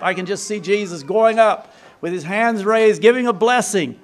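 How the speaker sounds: ordinary talk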